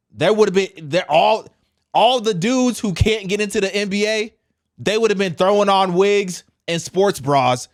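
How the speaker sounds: riled up, hammering it home; fired-up rant. Real, not acted.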